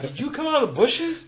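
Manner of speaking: shocked question. Retold or imitated. Startled by the girl who sprang out of the bushes. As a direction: ask it in sudden disbelief. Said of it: "And I'm like".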